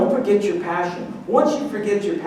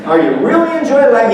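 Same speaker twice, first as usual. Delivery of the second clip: challenging loud question; 6 semitones higher, 7 dB louder than usual. Pretend. Mock challenge.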